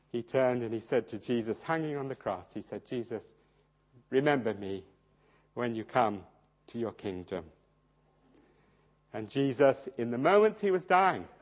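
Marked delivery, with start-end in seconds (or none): 2.8-7.5 quiet plea. Retold or imitated. Dying thief pleading on cross.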